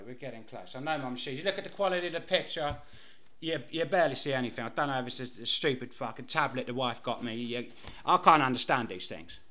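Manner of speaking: ordinary talk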